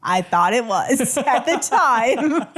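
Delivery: laughing through the words; giggling while telling it. Real, not acted. Laughing at her hallucination.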